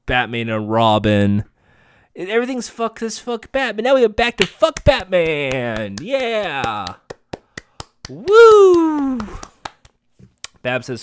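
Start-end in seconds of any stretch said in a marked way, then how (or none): 2.2-6.9 high-pitched, fast mock hype, stretching out the last words; mock celebration. Host hyping the show's return to cursing Batman, mock celebration. Cheering mockingly for the show's catchphrase. 8.1-9.2 high, loud "woo"; long celebratory whoop. Acted. Host cheering the recap along, loud high whoop.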